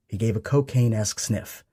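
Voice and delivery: usual speaking voice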